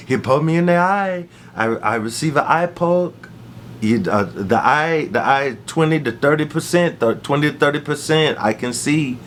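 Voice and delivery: imitated Cuban-accented English. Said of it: "in his Cuban accent, he begins to talk".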